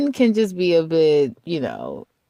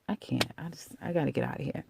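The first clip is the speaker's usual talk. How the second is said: much softer, muted exasperation; fed-up aside; 3 semitones lower, 12 dB quieter, faster than usual. Acted. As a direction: say it under the voice, wanting out. Imagined worker who has had enough.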